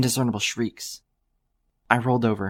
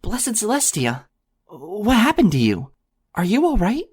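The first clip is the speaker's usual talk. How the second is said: exclaiming pony mare; 10 semitones higher, 3 dB louder than usual. A narrator's reading. Mare finds a shivering stranger, high alarmed exclaiming. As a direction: gasp it out, rushing to a stranger's side. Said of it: "all the while exclaiming".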